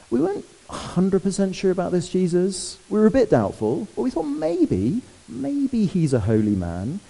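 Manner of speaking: high, doubtful, sneering voice, slowing on maybe; grumbling Pharisees. Retold or imitated. Preacher voicing Pharisees doubting Jesus, high and sneering. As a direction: play the suspicious religious elite. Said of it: "they grumbled".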